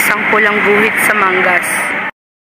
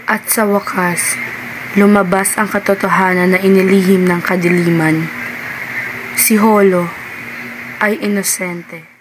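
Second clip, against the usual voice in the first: story narration; 2 semitones lower than usual. A narrator's reading. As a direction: let the truth land gently. Narrator reveals the truth. (mabagal na pagsasalaysay)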